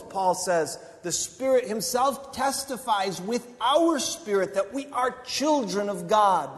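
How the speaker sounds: loud, high exhortation; fiery preaching. Acted. Preacher urging listeners to press on, loud and high. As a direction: drive them on like a revival preacher. Preacher quotes Romans loudly.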